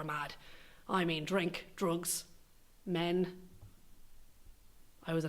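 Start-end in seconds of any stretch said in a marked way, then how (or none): none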